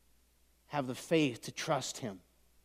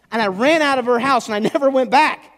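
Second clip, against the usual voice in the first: high, loud yelling; full-force shouted storytelling; shouted climax; 10 semitones higher, 14 dB louder than usual. Real, not acted. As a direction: yell it as the story peaks.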